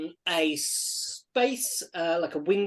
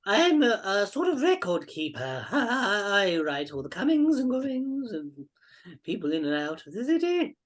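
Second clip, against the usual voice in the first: put-on eccentric old record keeper; 3 semitones higher than usual. Game master plays the old man.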